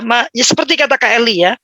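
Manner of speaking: ordinary talk, nothing marked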